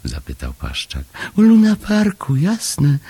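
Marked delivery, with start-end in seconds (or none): 1.4-3.0 high, loud little creature cry; excited cry. A narrator's reading. Chomek explaining the obvious to Paszczak, high excited cry. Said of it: "krzyknął Chomek".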